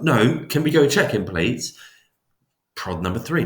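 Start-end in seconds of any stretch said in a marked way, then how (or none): 0.0-1.8 higher, louder urgent plea; pleading subject. Retold or imitated. Worried subject asks experimenter to check victim, higher louder plea. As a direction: push back, asking to go check on him.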